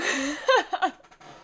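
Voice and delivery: a sudden loud laugh through tears; real laughter breaking through tears; teary laugh. Real, not acted. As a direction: laugh the crying off. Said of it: "when I get emotional, I c-, smile".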